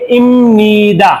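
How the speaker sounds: इमनिदा drawn out slowly with a clear m; slow pronunciation demo. Shown as a demonstration. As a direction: model इमनिदा slowly for students. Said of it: "इसको क्या बोलेंगे?". (इमनिदा धीरे और साफ़ बोलें)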